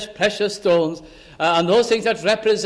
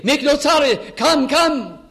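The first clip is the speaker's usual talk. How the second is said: calling up the street, urgent and raised; 6 semitones higher than usual. Retold or imitated. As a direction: hurry him home, anxious and pressing. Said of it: "Now there is an urgency".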